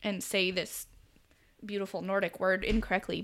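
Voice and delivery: ordinary talk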